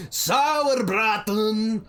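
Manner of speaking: put-on slow, drawn-out thick Zemnian accent. Zemnian vendor calls out his dish, slow thick Zemnian accent. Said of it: "a thick Zemnian accent".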